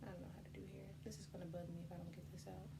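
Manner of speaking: soft muttered aside; muttering. Real, not acted. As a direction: say it under your breath. Muttered aside while fixing hair.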